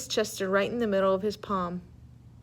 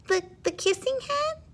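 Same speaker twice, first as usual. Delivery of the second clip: much higher, stammering small voice; curious tearful child. Acted. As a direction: sniffly kid perking up. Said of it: "asked Chester".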